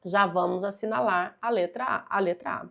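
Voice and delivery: usual speaking voice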